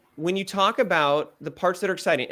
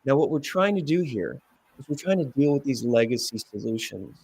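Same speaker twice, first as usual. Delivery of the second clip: softer, calm as if to a distracted child; 4 semitones lower than usual. Shown as a demonstration. Coach demonstrating a calm, lower-volume line. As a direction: soothe it, keep it calm. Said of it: "just like a calm".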